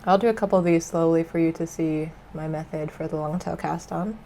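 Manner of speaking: usual speaking voice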